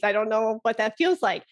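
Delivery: ordinary delivery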